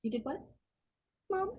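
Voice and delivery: hushed, halting question; acted quiet disbelief. Daughter hearing mom's confession, quietly stunned. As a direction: drop to a stunned hush.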